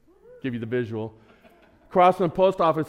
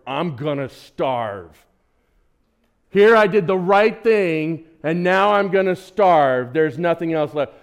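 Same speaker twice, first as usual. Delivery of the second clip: retold scream; 3 semitones higher, 7 dB louder, slower than usual. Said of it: "screamed to the Lord".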